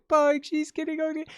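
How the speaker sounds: imitating her own emotional squeal; tearful joy retold. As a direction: relive the happy tears. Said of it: "I was like".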